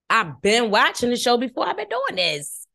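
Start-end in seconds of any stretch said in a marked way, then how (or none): 1.7-2.7 high, slow sing-song. Shown as a demonstration.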